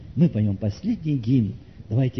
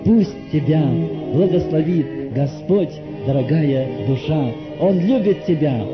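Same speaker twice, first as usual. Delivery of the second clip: fervent pleading that surges higher on key appeals; impassioned altar call; 6 semitones higher, 5 dB louder than usual. Real, not acted. Preacher invites dear soul to repent now, fervent appeal. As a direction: plead with them to come, swelling and easing.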